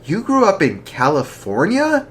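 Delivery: shocked. Acted. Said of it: "Gus said in shock".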